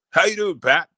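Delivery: a lightly voiced Rock greeting the host; imitating The Rock.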